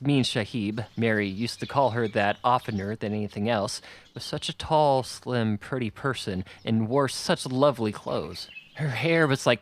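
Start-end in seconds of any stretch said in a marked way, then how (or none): none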